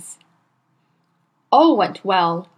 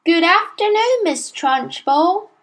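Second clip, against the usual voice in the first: high, slow sing-song class greeting; chirruping children; 9 semitones higher than usual. A narrator's reading. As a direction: chant it like a room of small children. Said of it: "they chirruped".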